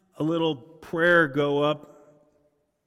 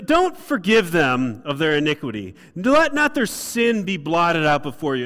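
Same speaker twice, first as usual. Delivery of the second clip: louder indignant plea; torqued prayer; 3 semitones higher, 6 dB louder than usual. Acted. Nehemiah asking God not to forgive, loud indignant plea. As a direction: demand God withhold forgiveness. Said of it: "Nehemiah's torqued".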